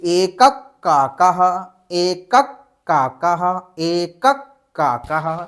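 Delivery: slow: एकक काकः with a doubled k, "ekak kaakah"; slow teacher demo of एकक काकः; demonstrating sandhi pronunciation. Shown as a demonstration. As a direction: say एकक काकः slowly, the visarga turned into a half क. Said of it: "कैसे".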